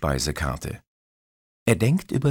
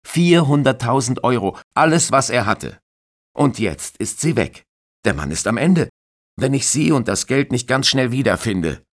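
insistent; 8 semitones higher, 8 dB louder than usual. Acted. Detective presses hard.

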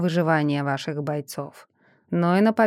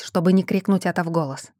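ordinary delivery, nothing marked

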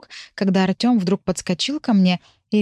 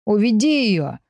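mild narrated shout; understated shout in narration; shouted order; 2 semitones higher, slower than usual. A narrator's reading. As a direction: call out urgently, without much volume. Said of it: "крикнул Тим".

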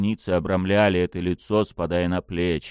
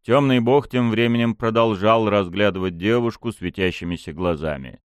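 ordinary delivery

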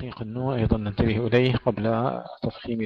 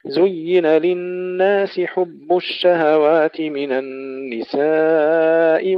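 slow, louder, drawn-out chanted recitation; Quran recitation; 4 semitones higher, 7 dB louder, much slower than usual. Acted. Reciter chanting the verses to his students in a slow tajweed style. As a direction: intone each verse slowly and resonantly.

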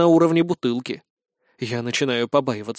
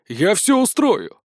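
eager promise; 5 semitones higher, slower than usual. A narrator's reading.

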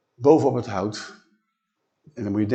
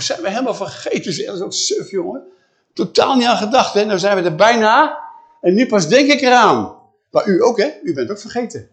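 higher, louder put-on son's voice, fretting; a boy scolding his father for forgetting; boy Isaac voice; 9 semitones higher, 8 dB louder than usual. Son Isaac fusses at his father, higher and louder.

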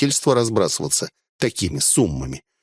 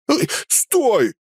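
high-pitched call to halt; crying out; 14 semitones higher than usual. A narrator's reading. Burnt bandit halting his partner, crying out. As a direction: call out sharply to stop someone. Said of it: "вскричал жжёный".

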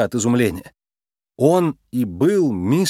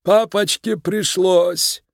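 high, slow creaky self-defence; creaky Mister Big; 8 semitones higher, much slower than usual. A narrator's reading. Mob boss justifies himself to his daughter, creaky and slow. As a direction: excuse it in a drawn-out little creak. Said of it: "Скрипучий голос мистера Бига звучал властно".

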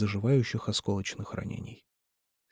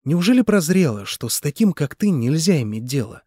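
gloating jab; 5 semitones higher, 5 dB louder than usual. Acted. Mitya gloats at Nikolai.

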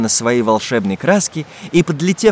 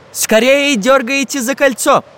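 high, drawn-out urgent call; shouted order; 11 semitones higher than usual. A narrator's reading. Самоделкин orders the parachutists to pull the ring, shouting. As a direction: call out a command to everyone. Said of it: "громко крикнул Самоделкин".

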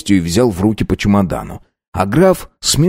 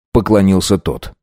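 usual speaking voice

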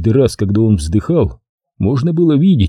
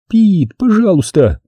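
slow, higher, pleading anger; angry exclamation; 9 semitones higher, slower than usual. A narrator's reading. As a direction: scold him sharply. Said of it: "сердито воскликнула Кэти".

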